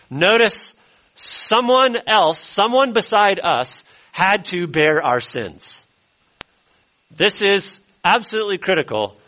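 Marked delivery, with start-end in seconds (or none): 0.1-5.8 drawn out, pitched higher, stressing "someone else"; slow and deliberate. Real, not acted. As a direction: linger on each phrase so it sinks in. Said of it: "I do not want us to move too quickly past this".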